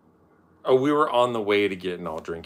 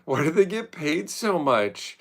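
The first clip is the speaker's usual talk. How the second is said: mocking whine; 9 semitones higher, 5 dB louder, slower than usual. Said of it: "They're like".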